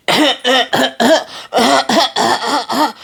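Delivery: a hammed-up wheezy cough; imitating grandma's cough; mock sick cough. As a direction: fake a sick person's coughing fit. Acting out grandma sick with Rona.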